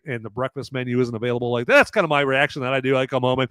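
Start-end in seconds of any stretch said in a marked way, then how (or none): none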